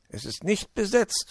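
high-pitched glad exclamation; acted delight; pleased fox. A narrator's reading. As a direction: lift the voice in happy relief. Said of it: "freute er sich".

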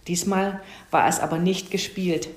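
plain reading voice

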